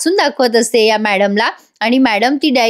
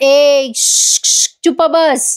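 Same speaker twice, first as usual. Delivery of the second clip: shushing; 3 semitones higher, much slower than usual. Retold or imitated. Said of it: "तोंडावर बोट ठेवून".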